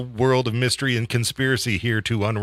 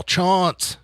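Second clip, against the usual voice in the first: high drawn-out call across the hall; calling a name; 9 semitones higher than usual. Retold or imitated. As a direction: call the name from another room. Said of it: "he's just all".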